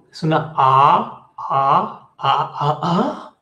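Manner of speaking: "A" held slowly and pushed through the nose; nasal a sound. Shown as a demonstration. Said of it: "con la nariz". ("A" nasal, lenta)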